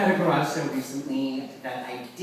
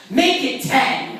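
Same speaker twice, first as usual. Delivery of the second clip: higher, louder, slow demand; mock shout; 9 semitones higher, 7 dB louder, much slower than usual. Woman shouting her lowball offer at mother. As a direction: bark the lowball like a pushy haggler. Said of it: "the woman shouts back".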